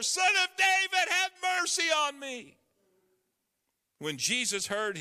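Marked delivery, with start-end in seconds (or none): 0.0-2.6 high, loud insistent cry; shouting louder. Acted. Beggar shouting past the crowd's hushing, louder plea. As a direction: cry out even harder over the crowd. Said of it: "But he only shouted louder".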